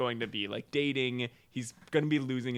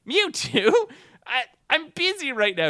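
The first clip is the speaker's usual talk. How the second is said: put-on higher, louder startled teen voice; flustered Ash; 12 semitones higher, 6 dB louder than usual. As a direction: caught off guard, shoo him away. Ash interrupted by Mewtwo.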